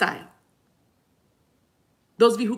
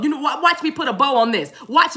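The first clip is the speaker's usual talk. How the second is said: ordinary delivery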